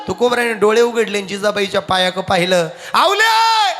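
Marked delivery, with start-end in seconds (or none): 2.9-3.7 high, ringing call; calling out to wife. Acted. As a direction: holler her name at high pitch. Tukoba बायकोला हाक मारतात. (मोठ्याने हाक मारत)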